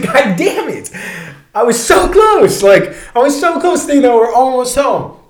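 hammed-up frustration at getting so close; exasperated wail. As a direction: wail it in comic despair. Retold hopes dashed so near home.

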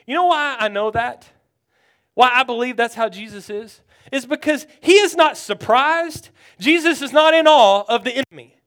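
urgent, driving sermon delivery; forceful preaching. Real, not acted. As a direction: press the congregation with conviction.

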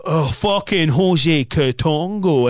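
slow, drawn-out Glaswegian insult; Glaswegian accent. Retold or imitated. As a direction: drawl it like a Glasgow bloke. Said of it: "And he did it in that voice".

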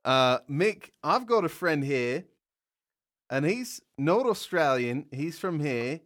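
shaky attempt at an Australian accent; amateur mock-Australian accent. Shown as a demonstration. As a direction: do a bad Aussie accent, drifting toward English. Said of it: "ready for the Australian accent".

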